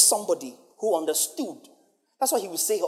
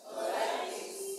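congregation echo; 12 dB quieter, slower than usual. Real, not acted. Congregation repeat, far off.

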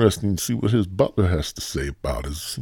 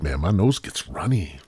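ordinary delivery

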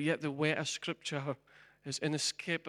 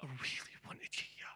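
soft, breathy-free unvoiced whisper, much softer; God's whisper; 3 semitones lower, 11 dB quieter than usual. God speaking tenderly to the lost soul, hushed whisper. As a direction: whisper it like a grieving father. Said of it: "And God said".

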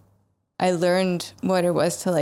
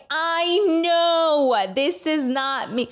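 put-on ranting to a girlfriend, exasperated and higher; 8 semitones higher, slower than usual. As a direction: vent it, slow and overwrought. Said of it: "I was like".

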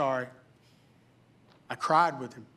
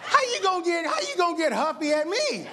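mock indignant rant; 12 semitones higher than usual. Preacher mocking the rude man, high indignant laughing rant. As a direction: rant at him, hammed-up and laughing.

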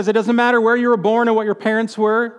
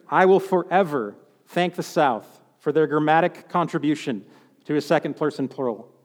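normal talking voice

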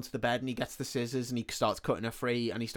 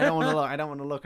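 higher, louder, rapid mock panic; retold frantic refusal, rushed and raised; freaking out; 4 semitones higher, 6 dB louder, much faster than usual. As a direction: blurt it out fast, imitating a panicked boy. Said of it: "like, fucking freaking out".

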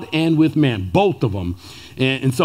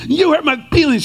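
put-on toddler voice; 10 semitones higher than usual. Imitating two-year-old daughter whining at her parents. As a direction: whine it like a hurt toddler. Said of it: "That one".

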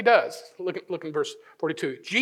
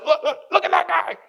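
much higher mock-scandalized voice; mocking pointing; 11 semitones higher, 5 dB louder than usual. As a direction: point out the sinner in a high, put-on voice. Mocking someone else's sin, acted.

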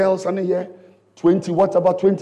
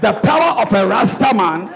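preaching at full force. Acted. Builds to loud preaching.

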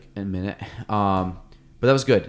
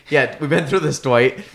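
weary cop; 4 semitones higher, 6 dB louder than usual. Retold or imitated. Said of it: "He goes".

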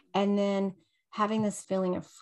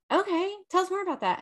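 curious friends; 11 semitones higher, faster than usual. Retold or imitated. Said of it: "they were like".

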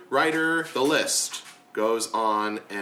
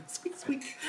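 squeaky whisper; 13 semitones higher, 12 dB quieter than usual. Acted. Mouse sound for Boo.

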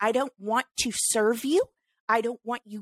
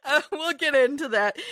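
laughing while speaking; 8 semitones higher, 3 dB louder than usual. Real, not acted.

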